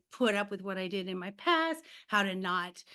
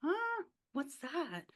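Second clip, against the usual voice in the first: puzzled surprise; 6 semitones higher, 7 dB quieter, slower than usual. Retold or imitated. Retelling own confused reaction.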